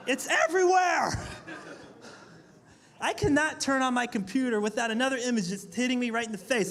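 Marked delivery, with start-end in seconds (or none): 0.1-1.1 high shouted outburst; exasperated shout. Real, not acted. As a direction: throw it out, fed up.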